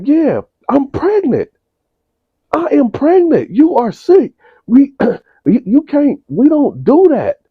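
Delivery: mock a shrieking scandalised mother; outraged mother. Mother scolding son.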